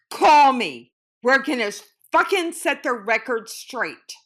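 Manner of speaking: loud, heated demand; angry command. Retold or imitated. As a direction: shout him down.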